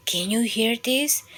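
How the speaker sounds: plain reading voice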